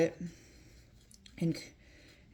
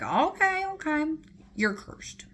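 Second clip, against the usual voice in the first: high, loud sing-song goddess voice; mock Aphrodite curse; 9 semitones higher, 4 dB louder than usual. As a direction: play the petty goddess laying a curse.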